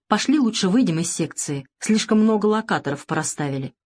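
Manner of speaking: ordinary delivery, nothing marked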